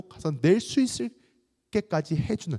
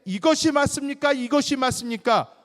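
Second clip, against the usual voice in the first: high, raised, pressing demand; insistent questioning; 13 semitones higher, 5 dB louder than usual. Acted. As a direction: play a crowd demanding a verdict. Acted crowd pressing Jesus for judgment.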